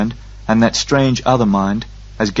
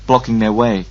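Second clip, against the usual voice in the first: ordinary delivery, nothing marked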